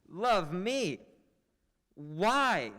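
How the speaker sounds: loud, high startled exclamations; astonished. Acted. Benedick overhearing he is loved, loud astonishment. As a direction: blurt out in disbelief.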